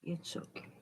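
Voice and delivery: soft unvoiced reassurance; whispering. Real, not acted. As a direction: whisper it to calm the bird. Whispering to the bird.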